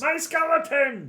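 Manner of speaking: high, loud, drawn-out wail; mock-anguished cry. Player as mace swings at the skeleton, wailing. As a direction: cry out for your precious minion. Protests attack on own skeleton.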